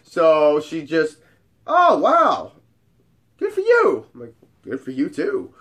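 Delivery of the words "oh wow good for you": high, loud amazed woman; mock impressed. Hot friend seeing his ID, exaggerated amazement. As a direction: imitate her marvelling at the ID.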